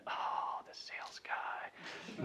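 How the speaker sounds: whispered inner thought, slow; whispered aside. Retold or imitated. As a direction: breathe it like a private realisation. Prospect's inner realisation.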